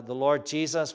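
normal talking voice

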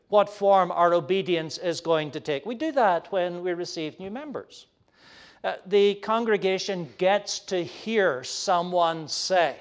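usual speaking voice

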